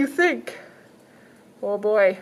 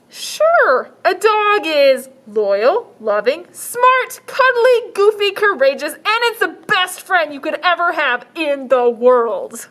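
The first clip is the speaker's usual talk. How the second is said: put-on high, slow, firm little girl; 6 semitones higher, 5 dB louder, slower than usual. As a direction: insist like a stubborn child. Girl lists dog traits.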